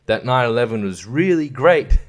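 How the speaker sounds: ordinary talk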